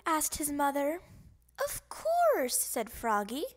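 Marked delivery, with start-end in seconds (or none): 1.6-2.6 put-on high, sure little kid's voice; kid's answer. Froggy answering his mother, high kid's voice. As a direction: answer like a confident kid.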